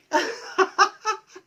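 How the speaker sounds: high, breathy demonstration laugh; demo laugh. Shown as a demonstration. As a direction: laugh out on the exhale. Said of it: "even laughing".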